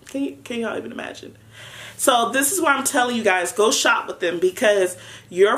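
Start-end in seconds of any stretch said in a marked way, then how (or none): 0.1-1.4 whispered aside. Real, not acted. Teasing aside about her reaction.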